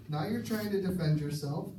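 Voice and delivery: normal talking voice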